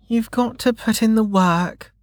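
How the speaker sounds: put-on high little-girl recital of a rule; child reciting. Child recites her father's rule.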